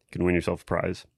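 ordinary talk

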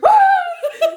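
high loud laughing scream; retold scream. Scared by her husband, loud laughing scream. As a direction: shriek at full lungs through laughter. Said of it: "I screamed at the top of my lungs".

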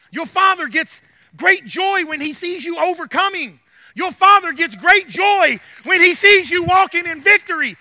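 rhythmic, building preacher's refrain; impassioned preaching. Real, not acted. Preacher telling congregation of their Father's joy, fervently. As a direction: lift each repeat with fervour.

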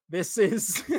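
breaking into a laugh. Real, not acted. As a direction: laugh as you start the line. Laughs at own spicy take.

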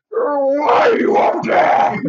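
slow, high, loud whining cry; high Grinch shout. Retold or imitated. [name] acting the Grinch crying up at Mary Lou, high and loud. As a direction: wail it up at her.

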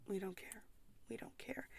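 whispered, breathy aside. Shown as a demonstration. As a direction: whisper it like a secret.

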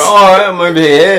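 put-on loud British accent slipping out; jokey, high-energy British accent. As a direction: call out in a mock-British voice. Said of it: "I let it slip sometimes at work".

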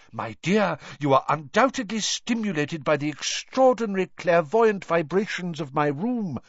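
high, theatrical professor voice; excited professor. A narrator's reading. Professor explaining the pupil's vision, high and theatrical. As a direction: enthuse grandly, pitched well up. Professor enthuses about her room.

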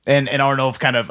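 usual speaking voice

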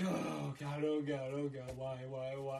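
softly moaning oh God; hushed panicked moaning; quiet despair. Retold or imitated. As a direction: groan it under your breath in dread. Retold panic.

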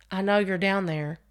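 plain reading voice

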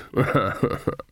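short sly snicker; put-on chuckle. A narrator's reading. Sharper proposes stakes to Granny, chuckling. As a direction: tack a chuckle onto the offer.

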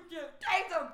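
high gleeful shout; excited. Pretend. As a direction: yell it, egging them on. Mock cheering arrest.

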